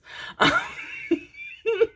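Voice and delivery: very high, squeaky giggle; giggling. Real, not acted. Reader being told off by ancestors, high giggle.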